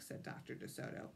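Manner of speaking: plain reading voice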